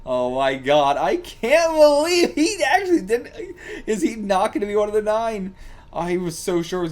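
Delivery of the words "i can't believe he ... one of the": real amazed high voice; disbelieving. Real, not acted. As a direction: stretch it out in disbelief. Disbelief at plot.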